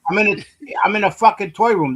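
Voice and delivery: loud, fed-up protest; exasperated outburst. Real, not acted. Defending himself after accent teasing.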